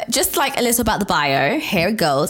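ordinary talk, nothing marked

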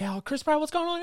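high-pitched mock reporter greeting; TMZ guy. TMZ guy greeting a celebrity at the airport, mock high-pitched. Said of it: "the T M Z guy's there".

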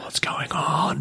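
whispered to himself, slow; a baffled thought under the breath; inner whisper. Retold or imitated. Lying awake beside the girl, whispering his confusion. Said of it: "in my head".

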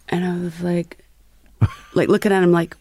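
ordinary delivery

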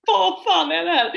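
half-laughing alarm at the scene; retold panicked what the hell. Retold panic during demo.

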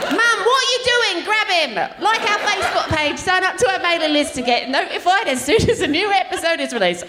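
put-on high-pitched, bossy British nanny accent; Super Nanny. Super nanny ordering Mum about between plugs, bossy British accent. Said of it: "Okay, do Super Nanny".

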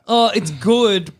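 high, slow hedging praise; cushioning compliment. Acted. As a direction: draw it out before the bad news. Voicing praise before the criticism.

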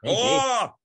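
high, loud gasp of shock. Real, not acted. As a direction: react in real shock. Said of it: "reverse pedoing".

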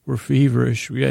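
usual speaking voice

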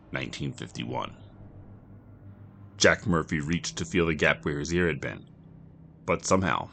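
usual speaking voice

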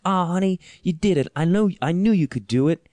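high, loud, beaming congratulations; imitating the backup singer cheering him after the set; proud sweet singer. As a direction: gush over him like a proud aunt. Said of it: "She's like".

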